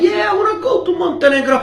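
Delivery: lightly acted eager reply, high and quick; playing eager tourist. Traveller telling officer his itinerary, mock eager. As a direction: pitch it up like a keen tourist.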